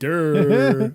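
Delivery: loud, drawn-out mocking "durr"; mock-dumb duh. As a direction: blurt it like stating the obvious. Mocking an obvious outcome.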